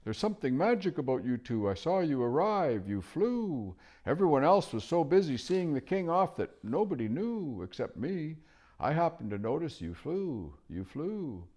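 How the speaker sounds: high, hooting owl voice. Acted. Owl quizzing the children about flying, curious and high.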